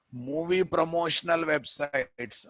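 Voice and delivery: usual speaking voice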